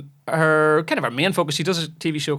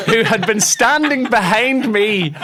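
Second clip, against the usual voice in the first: higher, louder, laughing while telling; laughing through it; 9 semitones higher, 7 dB louder than usual. Real, not acted. Host laughing at his own mistake, real laughter. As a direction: laugh the words out at yourself.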